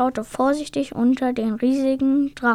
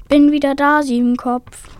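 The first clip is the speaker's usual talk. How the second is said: loud, raised call; 3 semitones higher, 7 dB louder than usual. A narrator's reading. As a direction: call out to announce you're back. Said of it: "und rief".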